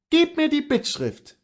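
high-pitched determined wife; insistent wife. Acted. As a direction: demand it firmly from your husband.

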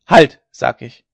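very loud, slow insistence; forceful, drawn-out order; shouted command. A narrator's reading. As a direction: shout it down with authority. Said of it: "schrie Hauke wieder".

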